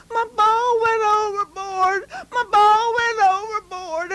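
put-on high, loud little-boy yell; a boy wailing that his ball is lost; hollering boy. Boy yells to everyone that his ball went overboard, high and loud. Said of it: "Bobby hollered".

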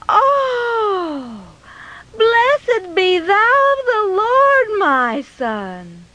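high, drawn-out exclamation; long high sigh into a blessing; deep relief. Acted. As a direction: gush slowly with hammed-up relief. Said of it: "she let out a deep sigh of relief".

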